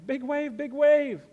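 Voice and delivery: high, loud squeals of joy; a little girl shrieking with glee at the waves; excited shrieking. Retold or imitated. Daughter squealing at the waves in the shallows, excited high shriek. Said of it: "shrieking with excitement and was giggling".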